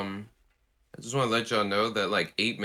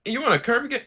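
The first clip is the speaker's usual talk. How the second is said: very high, loud mock squeal; mock pitch to fans; 6 dB louder than usual. Game makers offering fans a Kirby game, high mocking squeal. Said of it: "they're like".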